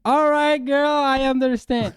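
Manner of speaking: high, loud sassy reply. Pretend. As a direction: toss it off with sass. Mock sassy English reply.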